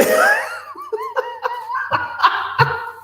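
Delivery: a long laugh, way up high. Real, not acted. Host laughing at a cricket noise on the stream, high squealing laugh.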